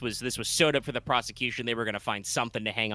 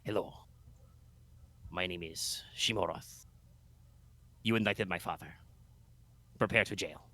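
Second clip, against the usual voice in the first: put-on a lightly done Spanish accent, lower and softer; 4 semitones lower, 8 dB quieter than usual. GM redoing Roth's line in a mild Spanish accent. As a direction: Inigo Montoya parody in a Spanish accent. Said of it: "I'm supposed to be more Spanish".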